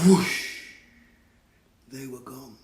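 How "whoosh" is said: breathy whoosh of a broom; whoosh sound. Shown as a demonstration. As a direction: blow the whoosh out like wind. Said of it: "say it with me".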